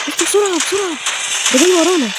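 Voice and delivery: very high, very loud frantic cries to hurry; real terror while being chased; panicked shout. Real, not acted. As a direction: scream at him to run. Fleeing the pursuer.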